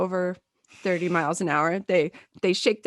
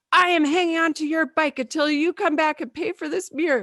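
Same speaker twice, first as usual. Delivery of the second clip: mock angry mom; 7 semitones higher, 4 dB louder than usual. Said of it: "and she's like".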